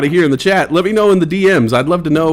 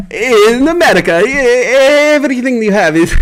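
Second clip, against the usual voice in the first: first try at a hammed-up Italian accent, pitched up; breaking into Giovanni's Italian voice; 6 semitones higher than usual. Streamer playing his Italian alter ego for chat, hammed-up Italian accent. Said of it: "introduce my friend, Giovanni Blanco".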